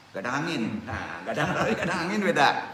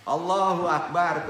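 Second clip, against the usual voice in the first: raised, drawn-out rallying cry; mock battle cry; 5 semitones higher, 5 dB louder, slower than usual. Preacher mimicking a misguided jihadist's cry, loudly. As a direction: call it out slow, loud and higher.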